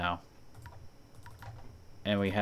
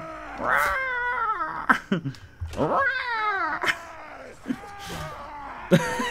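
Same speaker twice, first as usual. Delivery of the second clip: long high shrieking laughter; howling laugh. Real, not acted. Laughing at raven scene.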